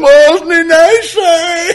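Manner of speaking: high, very slow, loud mocking coo; boy mocking the other lad's sweet voice. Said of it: "And started mocking him".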